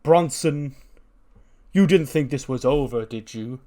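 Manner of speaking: angry wrestler calling out his rival; angry challenge. Retold or imitated. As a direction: bellow the challenge at him, slowing down. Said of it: "He's angry".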